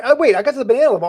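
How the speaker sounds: bright excited announcement. Real, not acted. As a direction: call it out, thrilled. Excited game discovery.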